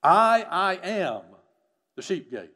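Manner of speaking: slow, weighty "I, I am the sheep gate"; solemn slow stress on "I am"; emphatic declaration. Shown as a demonstration. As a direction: land hard on "I am" in "I, I am the sheep gate". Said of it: "stated very emphatically".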